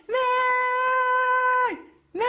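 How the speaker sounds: high loud whinnying neigh; horse neigh. Acted. Horse splashed by the donkey, shrieking neigh. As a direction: scream a startled horse's neigh. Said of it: "he screamed".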